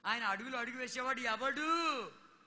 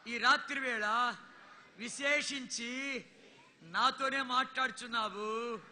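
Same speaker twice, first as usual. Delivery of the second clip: slow, drawn-out, louder prayer lines; led prayer; 4 dB louder, slower than usual. Acted. Preacher leading the congregation in a repeat-after-me prayer, slow and fervent. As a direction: pray it phrase by phrase for them to echo.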